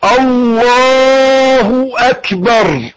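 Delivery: long slow chanted Allahu akbar; drawn-out takbir. Shown as a demonstration. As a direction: stretch God is greatest out like a call. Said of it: "قولها معايا، قول".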